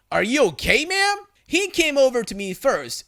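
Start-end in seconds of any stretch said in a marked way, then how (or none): 0.1-1.3 concerned question. A narrator's reading. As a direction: voice climbing high at the end. Worker checks on crying woman.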